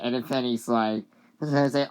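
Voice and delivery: ordinary talk, nothing marked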